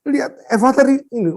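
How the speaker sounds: high, urgent call; retold calling out. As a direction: re-enact summoning the child's mother. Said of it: "saya panggil".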